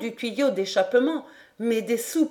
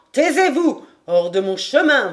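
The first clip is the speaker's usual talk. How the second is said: loud, raised theatrical shout; crying out orders; 5 semitones higher, 8 dB louder than usual. Acted. Boatswain silences the king in a storm, shouting. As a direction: explode into a stage command. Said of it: "quand il s'écrie".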